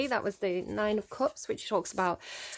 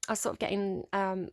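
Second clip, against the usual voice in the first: normal talking voice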